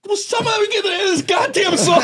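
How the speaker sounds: high, laughing shout; boy shouting in frustration; exasperated kid shout. Retold or imitated. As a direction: demand it, fed up. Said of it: "I go".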